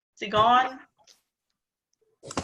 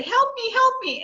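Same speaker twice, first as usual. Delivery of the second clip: much higher pleading cry for help; mock scared child; 13 semitones higher than usual. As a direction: call out like a scared child in the night. Child calling parent after nightmare.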